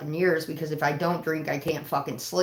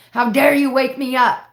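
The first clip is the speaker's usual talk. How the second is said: loud, higher mock outrage at being woken; mad retort; 6 semitones higher, 7 dB louder than usual. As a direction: snap back at the cops, hammed-up indignation. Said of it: "And I get mad as shit".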